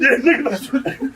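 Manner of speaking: slow, laughing yeah. Real, not acted. Conceding the tall tale through slow laughter. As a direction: agree while still laughing.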